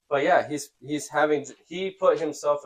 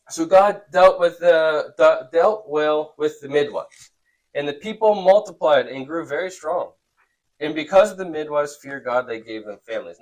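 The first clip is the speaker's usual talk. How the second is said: louder, deliberate read-aloud; scripture reading; 4 semitones higher, 8 dB louder than usual. A narrator's reading. Teacher reads God rewarding the midwives, deliberate reading. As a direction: project the verse to the room.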